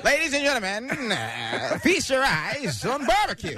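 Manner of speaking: high, slow carnival barker call; showman announcer. Pretend. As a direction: bellow it like a sideshow barker. Continues the sixties auctioneer bit.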